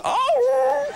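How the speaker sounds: high whoop, shouted; whooping yell. Shown as a demonstration. Host shows the whoop, "watch this.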